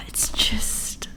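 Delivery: soft sigh into a whisper; swooning whisper. Real, not acted. Reader lost for words over a romance, sighing whisper.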